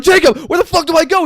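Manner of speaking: high, loud desperate yell; frantic shout. Retold or imitated. Player screaming at friend as boss approaches, loud. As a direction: scream for directions in panic. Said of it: "we just start shouting".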